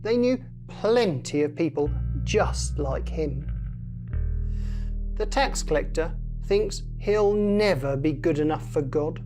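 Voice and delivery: plain reading voice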